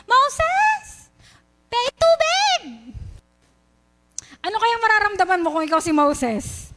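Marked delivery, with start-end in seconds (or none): 0.0-2.6 high-pitched acted shout of a thirsty crowd; mock crowd yell, pitched way up. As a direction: call out high, imitating people clamouring for water. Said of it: "yung ganong kadaming tao sumisigaw kay Moses".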